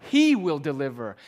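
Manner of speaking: HE hit hard and loud; stress on he. Shown as a demonstration. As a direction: punch the word he. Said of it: "And it's emphatic".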